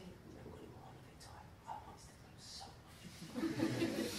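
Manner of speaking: very soft, breath-only put-on lament; mock heartbroken. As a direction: whisper it as if too upset to speak. Acts upset whispering to wife.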